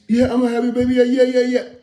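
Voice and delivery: high, giggly mock girlfriend; giddy girlfriend. A man imitating the girlfriend answering at gunpoint, high and giddy. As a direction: play her as thrilled to say yes, voice high up.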